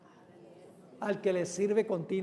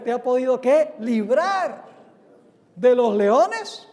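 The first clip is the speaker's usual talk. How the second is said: LIBRAR loud, then "leones" slow and rising; stress on LIBRAR; 3 semitones higher, 10 dB louder, slower than usual. Shown as a demonstration. Preacher drills the key word with the congregation, stress on LIBRAR. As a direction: hammer "librar" and lift "leones" into a question. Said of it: "Ahí está la palabra clave otra vez".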